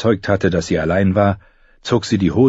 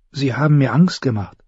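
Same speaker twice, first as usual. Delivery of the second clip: put-on young girl voice; 6 semitones higher than usual. Arya's voice in dialogue.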